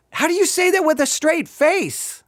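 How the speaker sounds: high-pitched exasperated disbelief; incredulous. Real, not acted. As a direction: ask it in baffled protest, pitch way up. Incredulous at the preacher's reading.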